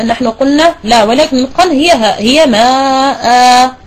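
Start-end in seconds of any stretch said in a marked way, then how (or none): 2.2-3.8 very slow "ماء" with a drawn-out alif; long alif. Shown as a demonstration. Teacher answers a student by modelling "ماء". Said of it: "ستنطقين بألف".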